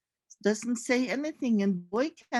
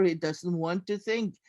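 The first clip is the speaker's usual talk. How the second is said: plain reading voice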